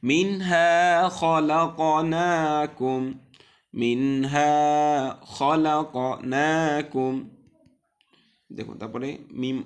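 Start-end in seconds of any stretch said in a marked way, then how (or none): 0.1-3.0 slow chanted recitation, louder; teacher chanting a verse for students; Quran recitation. Shown as a demonstration. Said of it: "teacher repeats recitation". 3.8-7.1 slow chanted recitation, louder; teacher chanting a verse for students; Quran recitation. Shown as a demonstration. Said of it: "teacher repeats recitation".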